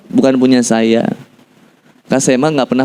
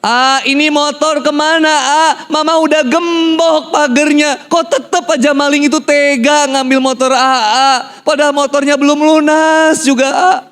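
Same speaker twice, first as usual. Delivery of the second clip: high, drawn-out mock wailing; stressed mother; 11 semitones higher, slower than usual. Mother laments the stolen, unpaid motorbike to her son, stressed wail. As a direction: play a distraught mother, voice pitched way up. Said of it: "ibu saya stres itu".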